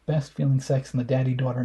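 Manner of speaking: ordinary delivery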